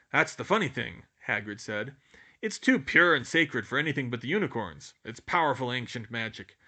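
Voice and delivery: a hint of the booming giant; lightly voiced Hagrid. A narrator's reading. Hagrid explaining, grim.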